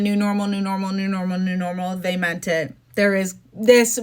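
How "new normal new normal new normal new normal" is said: mocking repetition. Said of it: "When they said over and over again".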